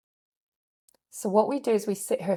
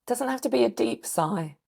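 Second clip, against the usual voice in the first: ordinary talk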